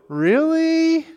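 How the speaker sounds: high, drawn-out doubting Really?. Retold or imitated. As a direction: stretch it up in disbelief. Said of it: "with that funny tone of voice".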